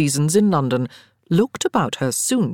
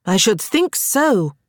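higher, slow, emphatic comeback; retort; 6 semitones higher, slower than usual. A narrator's reading. Sarah snubs her teasing sister Elizabeth, retorting. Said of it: "Sarah retorted".

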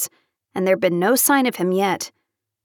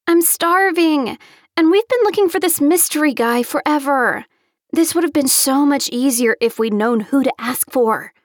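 whining; 5 semitones higher than usual. A narrator's reading. Best friend fed up with searching for mystery guy, whining. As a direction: complain in a whiny best-friend voice. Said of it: "the whine in my best friend's tone".

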